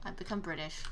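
low, soft, let-down delivery; deflated repeat. Real, not acted. Player repeating the interrupted punchline, deflated. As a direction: drop it quietly after a failed joke.

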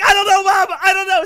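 high frantic shout; panicked kid yell. Retold or imitated. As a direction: cry it out in panic. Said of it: "I'm like".

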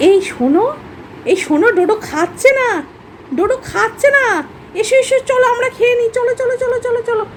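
high sing-song coaxing to a dog; high coaxing voice to a pet dog. Real, not acted. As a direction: tempt the dog by pretending to eat its food. Coaxing dog, pretending to eat.

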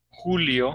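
"Julio" with a throaty H sound for J; J of "Julio" pronounced like a rough H; Spanish J. Shown as a demonstration. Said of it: "¿Cómo sería en español?".

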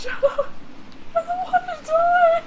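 high panicked yell; panicked shout. Real, not acted. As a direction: cry out in real panic. Panicked while being chased.

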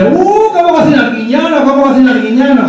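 high, swooping mock admiration; gushing admirers. People raving about a TV chef's cooking, high gushing. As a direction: gush high, starting on a long "uh". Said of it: "Que la gente dice".

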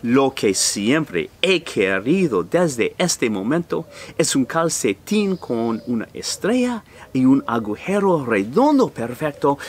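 usual speaking voice

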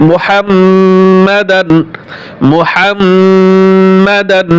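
মুহাম্মাদান very slowly with held nasal n; nasal ghunna. Shown as a demonstration. Teacher showing ghunna on the nun. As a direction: stretch মুহাম্মাদান with a humming n. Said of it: "নুনে গুণনা করবেন এক আলিফ".

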